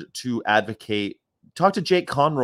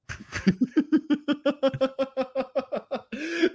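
high laugh; 13 semitones higher than usual. Real, not acted. Laughing at his own joke.

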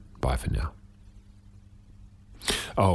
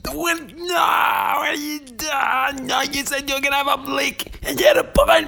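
put-on high, loud, enraged kid yelling; furious child shout; 6 dB louder than usual. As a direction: scream it like an outraged child. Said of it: "it was like exploding anger".

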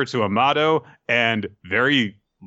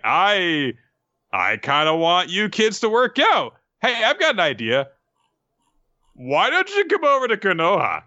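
high-pitched placating enthusiasm; mock-eager coaxing; 6 semitones higher than usual. Shikamaru coaxes Ada to come to Konoha, mock-eager and high. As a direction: reassure and sell the idea in a raised voice.

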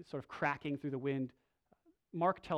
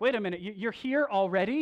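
louder, higher startled demons' question; startled demons; 5 semitones higher, 7 dB louder than usual. Retold or imitated. Demons startled to see Jesus already here, louder and higher. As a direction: imitate demons caught off guard, calling out. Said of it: "they're like".

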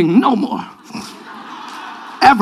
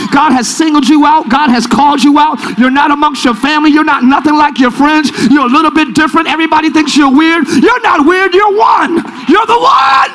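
rousing sermon shout, climbing higher; full-force preaching; 5 semitones higher than usual. Real, not acted. Preacher singling out the congregation, rising full-force shout. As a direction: build to a shouted declaration.

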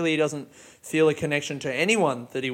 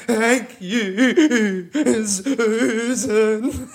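higher, louder, laughing through a fake sob; laughing mock thanks; 7 semitones higher, 3 dB louder, much slower than usual. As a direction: giggle out a pathetic thank you.

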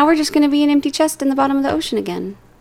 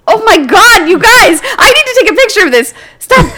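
higher and louder, amazed; overwhelmed surprise; 8 semitones higher, 5 dB louder than usual. Real, not acted. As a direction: exclaim in disbelief. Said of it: "everyone's giving me the horrible".

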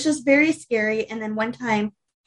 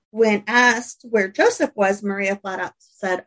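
ordinary delivery

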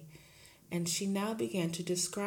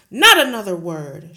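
very loud shout; 3 semitones higher, 20 dB louder than usual. Acted. Headmistress shouting to silence the teacher. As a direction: roar to cut her off. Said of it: "shouted Miss Trunchbull".

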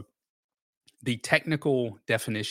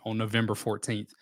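ordinary talk, nothing marked

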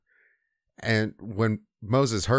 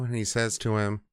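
plain reading voice

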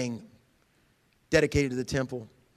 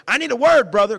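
much higher, louder plea; needy demand; 9 semitones higher, 12 dB louder than usual. Retold or imitated. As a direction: mimic someone begging for a word. People demanding preacher.

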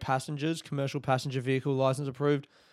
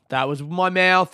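insistent; 4 semitones higher, 6 dB louder than usual. Shown as a demonstration. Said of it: "That wasn't a fart".

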